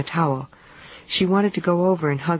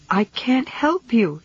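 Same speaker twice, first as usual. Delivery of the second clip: crying out; 7 semitones higher, slower than usual. A narrator's reading. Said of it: "she cried".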